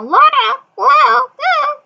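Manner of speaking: squawky high talking parrot; high screechy parrot imitation. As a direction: mimic the bird's squeaky chatter. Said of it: "They speak like that".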